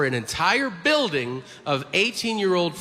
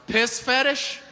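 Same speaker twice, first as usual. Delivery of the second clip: higher-pitched abrupt question; acted store man; 7 semitones higher than usual. Store man greeting a new customer, higher-pitched mock offer. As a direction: imitate a shady clerk making an offer.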